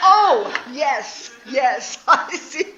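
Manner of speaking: loud, high, hammed-up outburst; exaggerated realisation. Wife suddenly seeing her husband's point, comically loud realisation.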